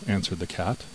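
ordinary delivery

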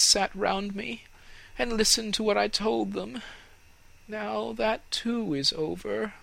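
high, wistful tree voice; wistful fir tree. Acted. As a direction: muse to yourself, longing. The tree muses after sighing.

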